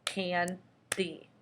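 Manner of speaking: candy stretched slowly into two chunks; can-dy drawn out for the class; slow syllable demo. Shown as a demonstration. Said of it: "when you're doing candy, you would go".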